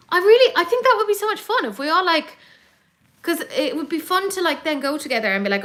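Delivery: high-pitched, quick enthusiasm; very excited. Real, not acted. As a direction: gush about the plan, tumbling ahead. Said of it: "I'm very excited about this idea".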